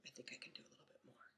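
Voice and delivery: fast, soft whispered reply; child quietly changing his mind, whispering. Retold or imitated. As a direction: mutter it quickly under breath. Son quietly deciding to continue.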